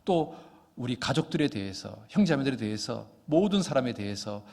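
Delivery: low-pitched, earnest congregational prayer; closing prayer. Real, not acted. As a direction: pray aloud on behalf of the congregation, pitched low.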